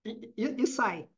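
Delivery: repeating the e sound, trailing off quietly; light demo of a blocked stutterer; stammer demo. Shown as a demonstration. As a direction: show the stutter that gives up. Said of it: "faz assim, ó".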